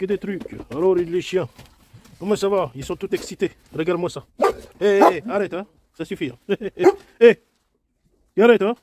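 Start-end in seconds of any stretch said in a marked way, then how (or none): 4.8-8.8 playful put-on voice scolding the dog; mock telling-off for the dogs, half laughing; silly pet voice. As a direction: shoo the pets in a teasing put-on voice. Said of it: "Il aime pas quand je fais cette voix-là".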